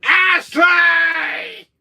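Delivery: loud, high drawn-out yell; hammed-up enraged name call; angry villain shout. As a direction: bellow the name in mock rage. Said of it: "When Wesker's more angrier".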